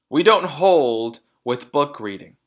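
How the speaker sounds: loud, slow, gruff declaration; stubborn loud dad; disdainful father. A narrator's reading. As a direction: pronounce it like a creed. Mr Wormwood voiced.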